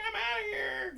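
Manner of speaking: very high, loud comic squeal; mock fleeing cry. Porn stars quit the chaotic championship, mock fleeing cry. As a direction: yelp it as if bolting from chaos.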